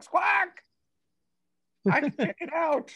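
very high squawking bird voice; seagull voice. Acted. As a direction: squawk it out like a gull. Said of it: "real seagull voice".

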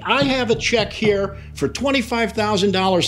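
high, heated imitation of an angry client; retold nasty threat. Lawyer acts out client threatening a bar complaint, nasty and high. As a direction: sputter the false starts, then threaten, pitched high. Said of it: "he is just getting nasty beyond belief".

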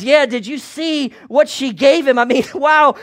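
high, loud, amazed chatter about the gifts; excited gossip. Acted. A townsman marvels at the king's gifts, high and loud. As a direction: gush over the treasure like a wide-eyed townsman. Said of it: "Then another responds".

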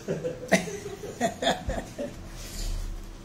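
airy, high-pitched chuckle; high breathy laugh. Real, not acted. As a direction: laugh softly at your own joke. Laughs at own joke.

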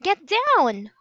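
put-on a boy yelling at his sister to duck; urgent warning. A narrator's reading. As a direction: yell it as things start spinning. Jack warning as treehouse spins.

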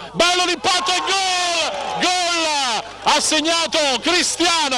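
shouted goal call, high and slow; breathless italian goal commentary. Real, not acted. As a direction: yell the goal with full joy.

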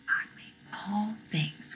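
soft breathy whisper of the answer. Shown as a demonstration. Teacher whispering the catechism answer to children. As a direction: whisper it as a hint. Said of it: "Say it with me".

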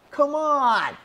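high, loud cry of protest; exasperated yell. Real, not acted. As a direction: yell out your frustration at losing. Just lost the game.

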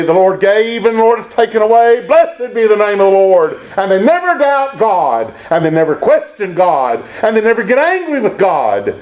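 high, fervent declamation; ringing, insistent preacher's cadence; impassioned preaching. Real, not acted. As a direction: drive each phrase home from the pulpit. Preaching at full force.

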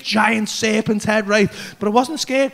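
ordinary talk

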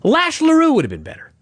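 exasperated high rant. Real, not acted. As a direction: vent disbelief at the booking. Ranting at bad gimmick.

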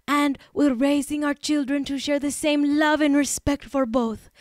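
loud, put-on Spanish accent with lisp; Ilaria Baldwin's fake Spanish accent. Host resumes the celebrity's statement, fake Spanish accent. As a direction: declaim it in a mock Spanish accent. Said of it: "I have to do this in the Ilaria accent".

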